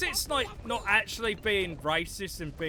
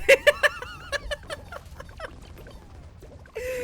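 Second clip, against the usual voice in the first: shrill laughing fit; high squealing laugh; 6 dB louder than usual. Real, not acted. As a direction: cackle high and long. Laughing at own impression.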